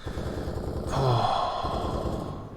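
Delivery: long, soft exhaled sigh. Real, not acted. Streamer losing it over the match, long sigh. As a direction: let all the air out in frustration. Sighs after losing patience.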